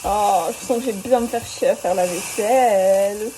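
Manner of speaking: ordinary delivery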